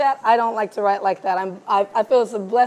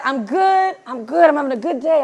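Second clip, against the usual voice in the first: higher, lilting upbeat reply; extra sparkle; 4 semitones higher, 3 dB louder than usual. Retold or imitated. Friend answering a check-in call, extra sparkle in the voice. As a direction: imitate a friend sounding unusually chipper. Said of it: "you sound a little extra sparkle in your voice".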